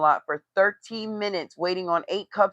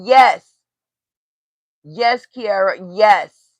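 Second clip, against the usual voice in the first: loud, drawn-out cheering yes; real excited agreement; 2 semitones higher, 10 dB louder, much slower than usual. Real, not acted. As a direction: hype her up with a loud slow yes. Cheering on a roast.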